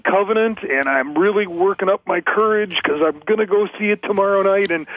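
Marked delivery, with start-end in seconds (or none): none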